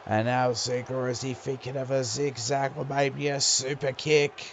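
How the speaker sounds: lively play-by-play call; wrestling commentator. Acted. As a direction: call the match as it happens.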